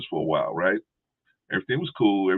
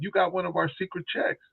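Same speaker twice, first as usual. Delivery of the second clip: high, salesy mock voice of a phone scammer; 10 semitones higher, 4 dB quieter than usual. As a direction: oversell it up high like a con artist. Scammer pitch.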